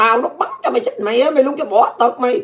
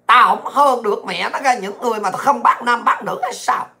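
ordinary delivery

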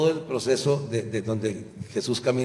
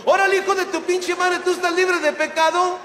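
very high, louder squeaky cursing; angry mock Jesus; 4 dB louder than usual. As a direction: snap back as a furious squeaky Jesus. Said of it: "se salió del libreto y me dijo".

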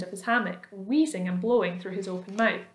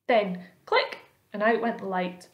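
ordinary delivery, nothing marked

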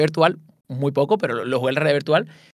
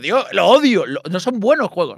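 mock indignant; 4 semitones higher, slower than usual. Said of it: "Y yo".

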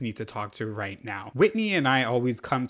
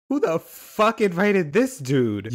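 higher, drawn-out outraged question; slow, raised-pitch indignation; indignant demand; 5 semitones higher, 4 dB louder, slower than usual. Acted. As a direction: demand it, appalled at the gatecrasher. Confronting an unwanted party guest.

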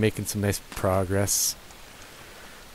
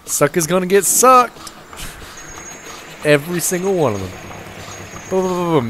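gleeful; 8 semitones higher, 4 dB louder than usual. Real, not acted. Gleeful gloating at machine.

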